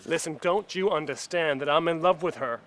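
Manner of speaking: loud, insistent declaration of love; impassioned plea. Acted. Man in love arguing with those keeping her from him, impassioned. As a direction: press the point forcefully. Lover pleads his case.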